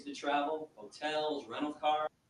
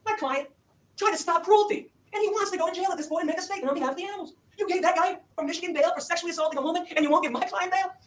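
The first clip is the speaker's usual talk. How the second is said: much higher and louder, building outrage; impassioned plea; 13 semitones higher, 8 dB louder than usual. Retold or imitated. Attorney pleads for his client's bail, loud and outraged. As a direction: rail at the judge's double standard. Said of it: "he turns and he says".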